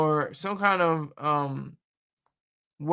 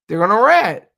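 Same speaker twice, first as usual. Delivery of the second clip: emphatic; 4 semitones higher, 9 dB louder than usual. Real, not acted. Emphatic prediction.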